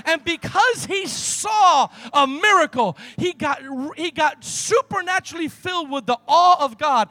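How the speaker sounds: loud, driving sermon delivery that builds to a shout; full-force preaching. Acted. Pastor to the congregation, telling the healing in a loud preach. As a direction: preach it to the rafters, pushing every line.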